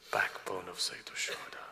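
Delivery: breathy reverent whisper of a title. Acted. Preacher whispering Ali Akbar's title to mourners, reverent. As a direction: whisper it like a secret.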